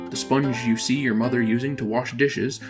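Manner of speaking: ordinary talk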